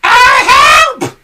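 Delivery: very high, loud hag scream; Mrs Twit screaming. A narrator's reading. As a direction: scream for help. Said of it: "screamed Missus Twit".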